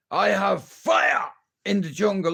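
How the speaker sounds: mock triumphant castaway cry; Tom Hanks. Castaway who made a fire, mock triumphant. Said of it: "like Tom Hanks".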